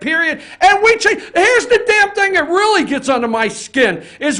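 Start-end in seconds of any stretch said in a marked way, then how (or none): 0.6-2.8 angry outburst. Real, not acted. Radio rant boiling over.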